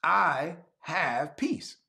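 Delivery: very slow, word by word; stretched-out, deliberate declaration; declaration of faith. Real, not acted. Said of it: "Declare it by faith".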